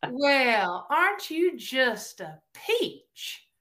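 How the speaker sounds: high, slow Southern drawl, mock-sweet; sweetly insulting. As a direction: insult them with drawn-out fake sweetness. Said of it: "all of that's insulting".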